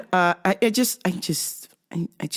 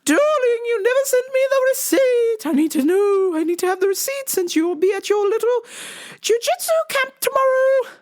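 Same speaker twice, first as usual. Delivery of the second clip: mom impression. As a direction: play the fussing mom calling darling, pitched way up. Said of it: "My mom goes".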